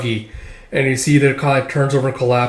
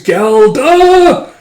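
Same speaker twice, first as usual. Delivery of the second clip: high, loud mock battle scream; imitated scream; 11 semitones higher, 7 dB louder than usual. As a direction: scream the name like a wild kid. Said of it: "And she screams".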